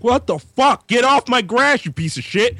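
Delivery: high, outraged mock yell; hammed-up cranky homeowner; angry neighbor. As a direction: blow up at kids on your lawn. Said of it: "He's like".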